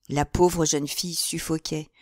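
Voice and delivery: ordinary delivery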